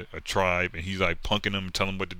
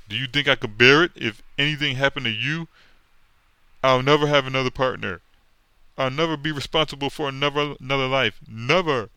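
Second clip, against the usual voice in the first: louder, higher melodramatic plea; hammed-up anguish; anguished hero; 7 semitones higher, 7 dB louder than usual. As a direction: play it like a soap opera. Hero's emotional speech.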